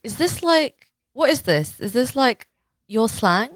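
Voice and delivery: high, slow, halting questions; puzzled reader. Acted. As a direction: query it as a confused reader. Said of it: "someone reading and being like".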